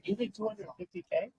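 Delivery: can't believe the number he just heard; incredulous surprise. Retold or imitated. Salesman reveals his income, stunned question. Hearing of huge candy-ball earnings.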